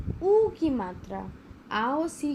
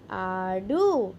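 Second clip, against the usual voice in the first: very slow, each sound held; slow drawn-out word; 4 semitones lower, much slower than usual. Shown as a demonstration. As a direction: sound it out for young pupils. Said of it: "spelling drill, slow blended word".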